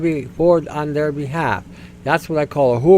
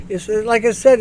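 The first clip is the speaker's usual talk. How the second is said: usual speaking voice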